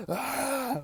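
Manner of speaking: high gasp then a high outraged ah; shocked gasp. Real, not acted. Appalled by her parents' two-hour timer, high gasp.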